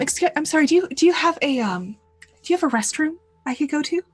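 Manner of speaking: an awkward guest who needs the restroom now; flustered polite voice. Acted. Flustered character asks for restroom.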